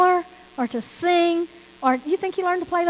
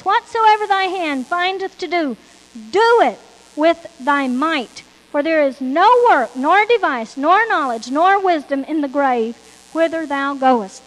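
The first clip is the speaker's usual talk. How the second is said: louder, ringing pulpit reading; preaching scripture; 5 dB louder than usual. Acted. Preacher proclaiming Ecclesiastes to the congregation, loud and ringing. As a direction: proclaim each clause of the verse. Reads scripture at full force.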